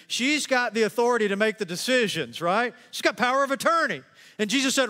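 high, loud, driving sermon voice; full-force preaching. Acted. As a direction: preach it out to the whole room.

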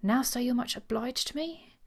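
goaded. A narrator's reading. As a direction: say it slowly, stung by the lack of encouragement. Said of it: "said in a goaded voice".

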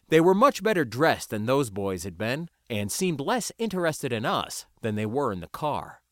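ordinary delivery, nothing marked

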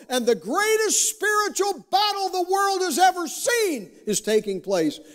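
high-pitched preacher building to a peak, then easing; impassioned preaching. Real, not acted. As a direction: build fervently, pitch climbing to a cry.

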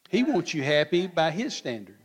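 normal talking voice

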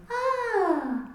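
put-on high squeal of a baby; high baby coo. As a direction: coo like an infant. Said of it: "the baby, like, goes".

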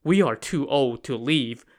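usual speaking voice

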